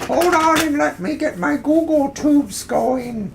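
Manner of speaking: put-on high, creaky old geezer voice; old coot voice. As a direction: play a cranky old coot. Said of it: "That's my old coot voice".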